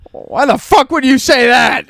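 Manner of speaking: slow, high, indignant yell; drawn-out furious question; outraged dad. Retold or imitated. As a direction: imitate a dad appalled at his son. Acting out dad's breakfast outburst.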